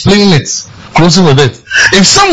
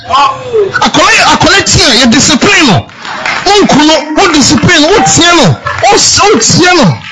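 higher, chanting sermon cadence; fiery preaching; 8 semitones higher than usual. Acted. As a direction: preach it with rising fervour, calling out each phrase. Preaching at full force.

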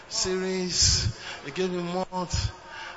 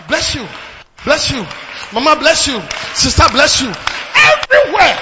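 loud, high blessing to each person; joyful preacher shout; 5 semitones higher, 12 dB louder than usual. Acted. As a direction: call the blessing out across the hall.

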